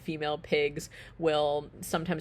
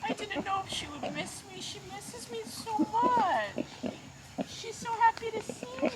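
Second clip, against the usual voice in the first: high sing-song cooing to a pig. Real, not acted. Cooing to her pet pig.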